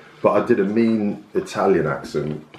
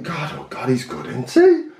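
put-on swooning girl; 5 semitones higher than usual. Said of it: "And they think".

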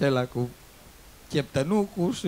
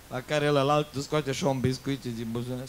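ordinary talk, nothing marked

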